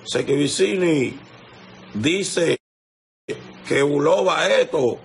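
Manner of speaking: high mock gossip voice. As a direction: sneer it out as if parroting a rumor-monger. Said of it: "No di que".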